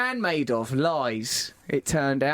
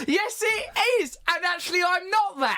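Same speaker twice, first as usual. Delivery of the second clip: indignant retort; 9 semitones higher than usual. Real, not acted. Mock quarrel over the voice.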